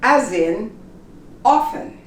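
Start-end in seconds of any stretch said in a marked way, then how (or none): 1.4-2.0 often said as "offen"; silent T. Shown as a demonstration. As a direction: drop the T in often. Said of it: "You don't pronounce the T".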